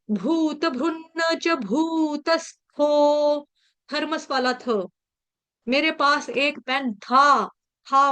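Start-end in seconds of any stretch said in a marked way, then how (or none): 0.1-3.5 slow, with a double न in भ्रुन्न and an aspirated थो in भूतस्थो; slow pronunciation demo. Shown as a demonstration. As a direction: spell out भूत भ्रुन्न च भूतस्थो syllable by syllable. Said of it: "तो शब्द क्या है". (धीमे, साफ़ उच्चारण में भ्रुन्न और भूतस्थो)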